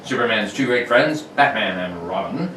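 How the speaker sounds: put-on old-time radio narration; radio announcer. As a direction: narrate like a 1940s serial.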